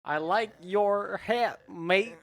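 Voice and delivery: put-on Australian accent. Guest doing an accent on request, slow comic Australian. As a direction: put on a drawn-out Aussie accent.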